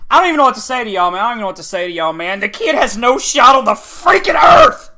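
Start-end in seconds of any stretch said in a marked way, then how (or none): 2.4-4.9 frustrated, higher-pitched shout; real gamer rage; angry outburst. Real, not acted. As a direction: build to an exasperated shout. Raging at the opponent.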